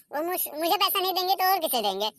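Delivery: normal talking voice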